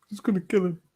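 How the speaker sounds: high mock-fearful wail; mock panicked mum. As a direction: echo the frantic mum's cry. Friends echo the panicked mum.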